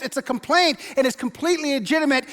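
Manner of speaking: usual speaking voice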